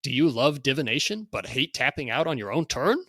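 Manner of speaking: loud, high hyped sales pitch; carnival barker. Acted. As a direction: hawk it like a sideshow barker. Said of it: "read this in sort of a carnival barker type voice".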